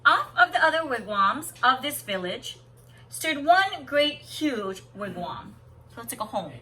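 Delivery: lilting read-aloud storyteller voice; story narration. Acted. Teacher reading a picture book to students, lilting storybook voice.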